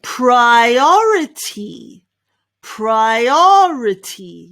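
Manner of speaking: PRIORITY stretched as pry-OR-ruh-tee, punching OR; slow model pronunciation. Shown as a demonstration. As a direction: draw out PRIORITY, stress on OR. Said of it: "the way to pronounce this is".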